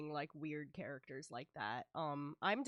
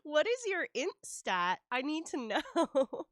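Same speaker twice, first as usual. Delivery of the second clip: mock serious; 9 semitones higher, 9 dB louder, slower than usual. Real, not acted. Teasing demand about int stat.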